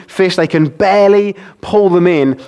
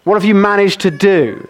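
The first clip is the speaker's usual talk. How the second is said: ordinary talk, nothing marked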